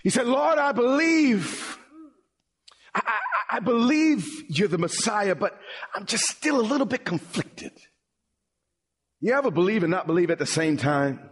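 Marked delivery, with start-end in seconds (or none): 0.3-7.7 tearful plea. Retold or imitated. The father pleading with Jesus, mock tearful and high. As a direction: beg with a catch in the voice, half-imitated. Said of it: "with tears running down his face".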